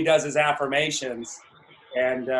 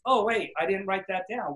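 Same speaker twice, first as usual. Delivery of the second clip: normal talking voice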